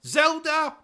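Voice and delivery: high-pitched, indignant outburst; indignant scorn. Acted. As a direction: spit the name back in high disbelief. Scoffs at co-host's mention of Zelda.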